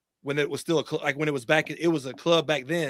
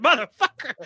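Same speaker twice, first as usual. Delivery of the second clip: high, loud cry of pain; mock yell; 10 semitones higher, 5 dB louder than usual. Acting out the bitten character, in a high mock yell. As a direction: yelp it like a bitten man. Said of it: "he's like".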